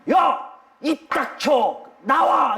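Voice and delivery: high-pitched commands, word by word; stern rebuke. Acted. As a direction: order the demon out with authority. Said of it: "꾸짖어 이르시면". (높은 소리로 엄하게 꾸짖으며 명령)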